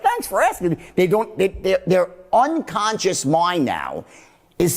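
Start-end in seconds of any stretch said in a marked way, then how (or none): 0.0-0.8 high, surprised thanks; polite reply. Retold or imitated. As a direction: thank them, caught off guard. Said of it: "They're like".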